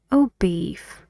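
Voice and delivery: "beef" dropping down to finish; closing fall on "beef"; falling tune on beef. Shown as a demonstration. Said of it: "it goes lower".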